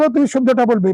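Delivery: ordinary talk, nothing marked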